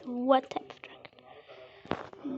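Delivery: whisper. Real, not acted. Asking in-game repeatedly, annoyed.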